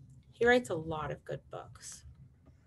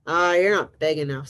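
loud, dismissive storybook brush-off; grouchy ladybug; 7 dB louder than usual. Acted. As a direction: brush them off like a huffy bug. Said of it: "said the grouchy ladybug".

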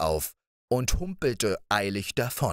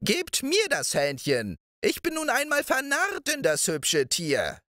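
put-on old moor witch. A narrator's reading. Old witch cooing over Balthasar's hen, high crooning voice.

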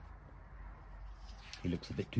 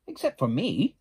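louder and higher, eager little duck; 6 semitones higher, 7 dB louder than usual. Acted. The duck offers friendship to Little Beaver, louder and higher. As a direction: pipe up brightly, higher and louder.

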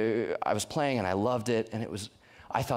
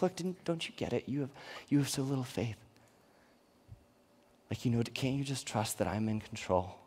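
hushed, gently pleading acted Jesus; softly chide, as if let down by a friend; soft imagined reproach; 6 dB quieter than usual. Preacher voices Jesus chiding doubting disciples.

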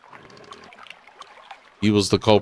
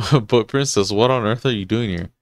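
ordinary talk, nothing marked

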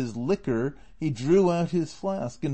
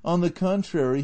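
usual speaking voice

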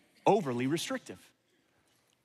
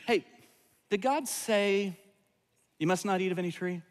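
innocent serpent question; 2 semitones higher than usual. Retold or imitated. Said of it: "Or, or did he say it with an attitude?".

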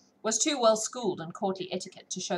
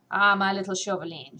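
unconcerned gaiety; 6 dB louder than usual. A narrator's reading. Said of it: "with unconcerned gaiety".

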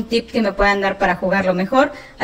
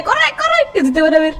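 high frantic cry; panicked; 4 semitones higher than usual. Real, not acted. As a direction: suddenly yell to run. Sudden warning mid-sentence.

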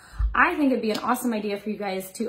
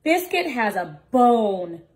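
ordinary delivery, nothing marked

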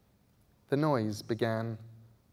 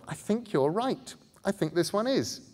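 put-on higher, uncertain boy asking; boy's voice; 9 semitones higher than usual. A narrator's reading. Bod checking with Scarlet, higher and unsure. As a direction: ask her carefully.

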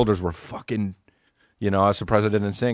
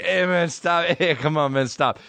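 whiny put-on plea, pitch easing down; drunk friend; 6 semitones higher than usual. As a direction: imitate a helpless drunk begging to be left alone. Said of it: "he would just fall down to the ground".

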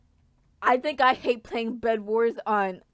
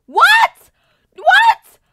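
very high screaming "what"; shocked shriek; 16 dB louder than usual. Real, not acted. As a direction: shriek in disbelief. Sudden shock in game.

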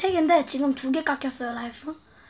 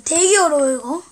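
ordinary talk, nothing marked

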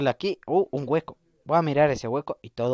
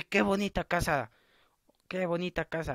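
ordinary talk, nothing marked

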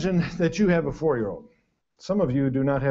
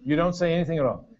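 ordinary talk, nothing marked

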